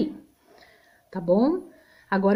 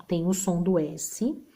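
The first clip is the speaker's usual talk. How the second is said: ordinary delivery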